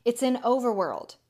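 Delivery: ordinary delivery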